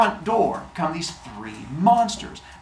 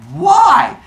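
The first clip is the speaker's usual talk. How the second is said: much higher, louder outraged why; shocked why; 9 dB louder than usual. Acted. Zeus hearing of the axe: high shocked outburst. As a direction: blurt it in disbelief.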